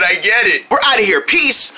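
usual speaking voice

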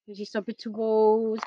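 usual speaking voice

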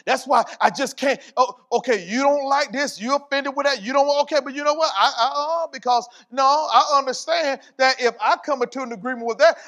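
high, loud, driving preacher's run; impassioned preaching. Real, not acted. Preacher refusing to come into agreement with offence, impassioned.

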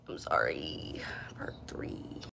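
breathy unvoiced whisper; whispered aside. Real, not acted. Apologising to viewers in a whisper. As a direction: drop to a bare whisper for the aside. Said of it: "aside whispered".

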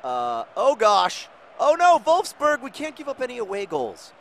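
high, loud alarmed cry; panicked. Acted. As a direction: panic as the opponent attacks. Opponent attacking in game.

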